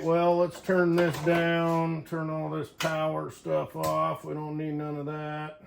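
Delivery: ordinary talk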